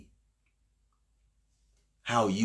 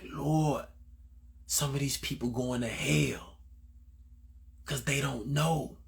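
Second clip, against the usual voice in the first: higher, pleading prayer through tears; tearful prayer; 5 semitones higher than usual. Retold or imitated. Praying for strangers at Walmart, tearful plea. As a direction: plead with the Lord as if near tears. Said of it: "I'm crying".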